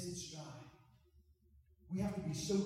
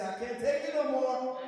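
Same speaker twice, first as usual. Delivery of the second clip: "I can't take it no more" cried out loud and much higher; shouted call for the congregation; 9 semitones higher, 10 dB louder than usual. Shown as a demonstration. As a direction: belt it out so the church repeats it. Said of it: "Come on, somebody say".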